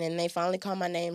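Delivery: usual speaking voice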